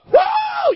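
high, loud "woo"; excited whoop. Real, not acted. Preacher whoops after shouting his catchphrase, excited high whoop. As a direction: let out a piercing whoop.

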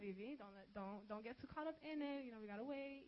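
softly cautioning herself; hushed, talking herself down; quiet self-warning. Retold or imitated. Warning herself not to fall for him, quiet. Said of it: "I told myself".

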